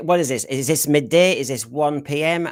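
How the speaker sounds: ordinary talk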